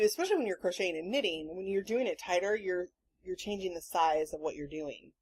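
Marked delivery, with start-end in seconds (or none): none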